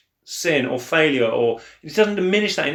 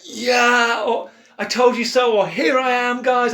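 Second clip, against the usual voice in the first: mock triumphant; 7 semitones higher than usual. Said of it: "He's gonna, like".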